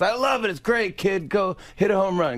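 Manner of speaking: high, excited comic cheering someone on; Adam Sandler. Retold or imitated. Comedian giving the kid his blessing, high imitated enthusiasm. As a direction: imitate a delighted comedian's send-off. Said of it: "He goes".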